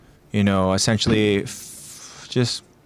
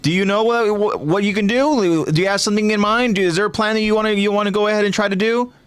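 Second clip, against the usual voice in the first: high, rapid-fire mock questions; condescending; 10 semitones higher than usual. Eren mocking Hanji's hope of stopping him, condescending. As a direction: fire off questions you already know are pointless. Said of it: "condescending, like".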